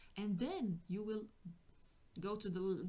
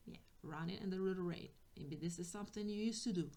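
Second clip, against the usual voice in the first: ordinary talk, nothing marked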